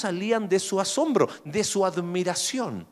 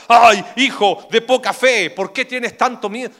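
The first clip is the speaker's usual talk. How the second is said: mock rebuke; 7 semitones higher, 9 dB louder than usual. Parent mockingly scolds a frightened son, loud and high. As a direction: mockingly lecture the child. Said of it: "no le digas".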